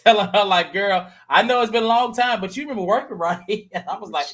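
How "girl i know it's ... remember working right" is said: higher, pointed, talking down; lightly voiced condescending challenge; challenging. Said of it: "She was challenging her ass".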